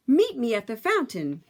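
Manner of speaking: plain reading voice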